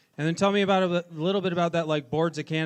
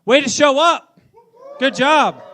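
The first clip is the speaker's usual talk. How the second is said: loud, laughing cheer to the crowd; cheering shout; 8 semitones higher, 9 dB louder than usual. Real, not acted.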